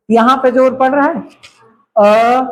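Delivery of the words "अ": usual speaking voice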